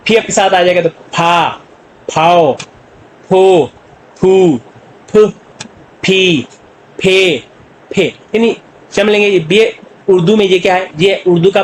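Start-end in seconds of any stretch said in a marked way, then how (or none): 0.9-8.0 "फा, फाओ, फो" with a puff on each फ; aspirated sound. Shown as a demonstration. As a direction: separate drilled syllables, each फ aspirated, vowel held. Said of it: "aspirated के साथ". ("फा, फाओ, फो" में फ महाप्राण, रुक-रुककर)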